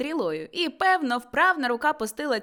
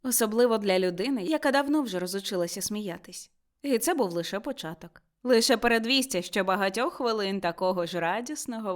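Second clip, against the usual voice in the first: usual speaking voice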